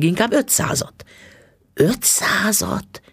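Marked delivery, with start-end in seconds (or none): 1.7-3.0 high, slowly stretched question; drawn-out, pitched-up amazement; astonished echo. A narrator's reading. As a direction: repeat the sum in disbelief. Wife echoes the sum, amazed.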